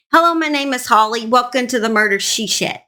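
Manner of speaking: ordinary talk, nothing marked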